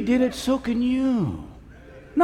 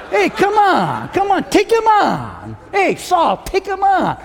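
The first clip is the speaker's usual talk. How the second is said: high, drawn-out calls to fight; acted rallying cry; urgent challenge; 10 semitones higher, 3 dB louder, slower than usual. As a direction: egg the soldiers on, pitched high. Voices David urging soldiers to fight.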